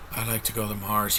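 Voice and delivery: low, listless mock-bored impression; bored actor. Reviewer mocking the lead who wants to go to Mars, bored. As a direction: imitate an actor bored out of his mind. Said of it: "He sounds bored out of his mind".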